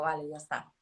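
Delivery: soft resigned "Vale, ya está", trailing off; a hushed, letting-go "Vale, ya está"; quiet settling. Retold or imitated. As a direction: let "Vale, ya está" drop quietly, almost to yourself.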